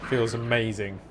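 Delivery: ordinary delivery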